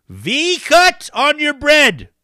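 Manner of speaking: high, slow and loud proclamation; big announcer reveal. Acted.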